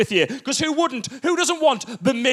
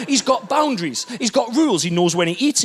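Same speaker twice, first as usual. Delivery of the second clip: ordinary delivery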